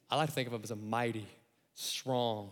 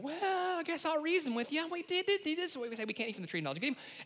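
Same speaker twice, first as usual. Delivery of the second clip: high, flustered Eve stammering; hammed-up Eve; 14 semitones higher than usual. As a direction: play her dithering and unsure. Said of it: "And Eve's like".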